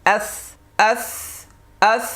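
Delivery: আস with a long lisped th-like ending; tongue-touched final s. Shown as a demonstration. Teacher drilling Arabic tha, tongue-touched fricative. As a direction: put the tongue to the teeth to end আস. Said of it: "এবার জিব্বা লাগবে তো".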